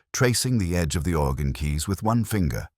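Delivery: plain reading voice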